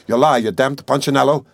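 loud, angry roared insult; unrestrained roar. A narrator's reading. Suspect cursing the lawyer, roaring without restraint. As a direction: hurl the insult at full voice. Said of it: "roared Raskolnikov, without restraint".

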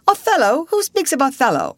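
high, loud, pompous outburst; theatrical actor. A narrator's reading. Old actor interrupting talk of Othello, theatrical outburst. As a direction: declaim like a self-important stage actor. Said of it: "tried to give himself the airs".

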